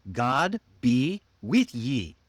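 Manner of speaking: slow, GOD, BE, WITH YE spaced apart; long, separate words. Shown as a demonstration. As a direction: spell out the old phrase word by word. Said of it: "was a very long sentence".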